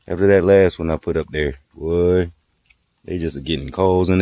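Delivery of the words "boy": ordinary delivery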